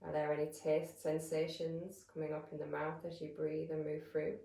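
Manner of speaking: calm, measured meditation voice with long pauses; guided meditation. Real, not acted. Yoga teacher settling listeners into breath awareness, calm guided meditation. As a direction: guide them gently into stillness, leaving space between phrases.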